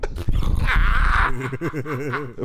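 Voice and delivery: long helpless laugh; real laughter. Real, not acted. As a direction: crack up at a friend's dark joke. Cracking up at shirt joke.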